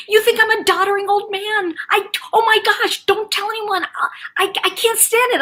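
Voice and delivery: high-pitched mock distress; mortified father. Daughter mimicking her embarrassed dad, agitated. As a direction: imitate an agitated old man pleading.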